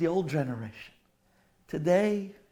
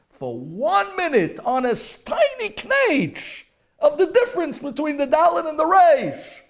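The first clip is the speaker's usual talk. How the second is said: impassioned preaching; 14 dB louder than usual. Acted. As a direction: build to a fiery peak. Preacher's climax.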